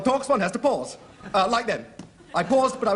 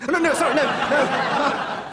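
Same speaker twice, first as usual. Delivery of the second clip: flustered demo; 4 semitones higher, slower than usual. Shown as a demonstration. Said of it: "Sorry, just demonstrating".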